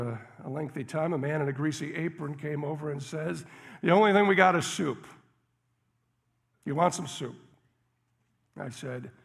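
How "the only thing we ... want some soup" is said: gruff cook. Retold or imitated. Gruff cook in crowded diner.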